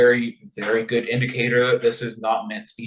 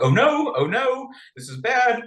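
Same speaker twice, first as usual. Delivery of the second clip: mock panic; 10 semitones higher than usual.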